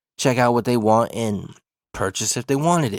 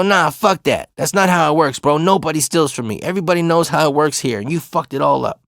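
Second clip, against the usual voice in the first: higher-pitched angry retort; heated telling-off, pitch raised; pissed off; 4 semitones higher than usual. Retold or imitated. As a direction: retell an indignant refusal. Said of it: "but I'm pissed".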